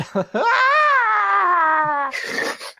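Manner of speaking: long, loud, high-pitched shriek; hammed-up fangirl meltdown; breaking down squeal. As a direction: let out a sustained falsetto squeal. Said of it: "She just breaks for a minute".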